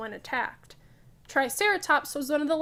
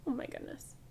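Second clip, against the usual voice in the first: whispered "Oh my goodness"; whispered aside; 14 dB quieter, faster than usual. Real, not acted. Teacher reacting to the picture for the kids, whispered. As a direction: breathe "Oh my goodness" under your breath.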